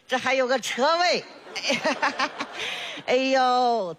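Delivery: ordinary delivery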